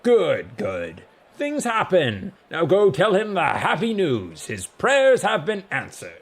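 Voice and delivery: loud, slow, grand pronouncement; big, dismissive god voice; blustering god. A narrator's reading. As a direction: declare it grandly, as a self-satisfied god.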